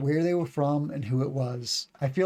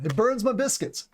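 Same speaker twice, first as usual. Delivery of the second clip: folksy Southern US idiom, pitched up; Southern accent; 7 semitones higher than usual. Acted. Teacher showing a very Southern idiom, Southern accent. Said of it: "This is very Southern".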